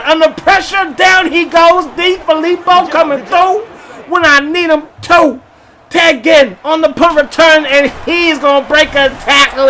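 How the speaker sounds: shouting the big plays as they happen; excited call. Real, not acted. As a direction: sell the sack and the return.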